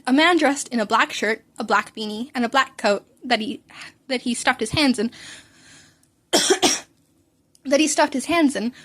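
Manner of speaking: ordinary delivery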